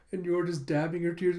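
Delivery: ordinary talk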